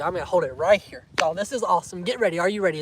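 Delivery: ordinary delivery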